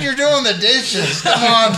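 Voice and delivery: high-pitched, drawn-out mock exasperation; laughing protest. Caller catching friend doing dishes, laughing protest.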